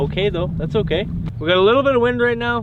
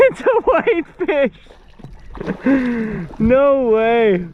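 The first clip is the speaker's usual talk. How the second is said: high, loud, disbelieving shout; esports caster; 6 semitones higher, 3 dB louder, much slower than usual. Real, not acted. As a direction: yell it like the big play just landed. Surprise catch, disbelief.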